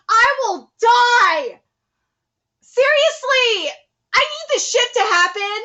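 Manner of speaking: high, slow, emphatic pleading; fervent fan gushing. Real, not acted. Viewer wants this ship to happen, fervent gushing. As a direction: stretch it out with overexcited longing. Wishes same for favourite couple.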